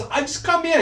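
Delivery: high, defensive customer; flustered customer. Retold or imitated. Customer defending himself to the barber, high and flustered. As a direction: protest up high. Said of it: "he turns and goes".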